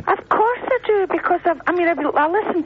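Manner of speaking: high-pitched, emphatic reassurance; real, high insistent objection; insistent protest. Real, not acted. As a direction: leap up high to protest your devotion. Protests she does care for him.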